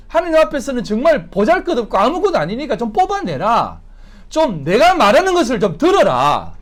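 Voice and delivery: loud, high, pleading reprimand; impassioned preacher voicing a divine reproach; God's exasperated rebuke. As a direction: raise your voice as God scolding the people. Preacher voices God rebuking the churches.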